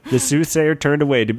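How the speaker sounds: normal talking voice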